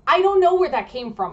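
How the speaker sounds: high loud disbelief; baffled exclamation. Real, not acted. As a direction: sound thrown by the idea. Baffled at outlet meaning consent.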